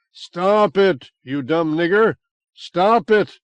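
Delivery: loud, commanding bellow; thundering. Acted. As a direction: pull out all the stops and thunder. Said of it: "I thundered".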